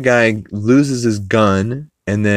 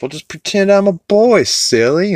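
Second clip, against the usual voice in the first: put-on teasing girl; 12 semitones higher, 3 dB louder than usual. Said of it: "And he's like".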